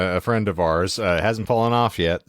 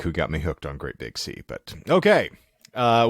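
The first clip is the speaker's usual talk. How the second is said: ordinary talk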